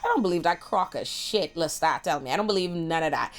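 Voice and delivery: normal talking voice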